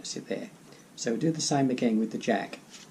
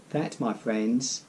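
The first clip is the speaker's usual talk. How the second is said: card magician patter; much slower than usual. Acted. Magician's reveal flourish.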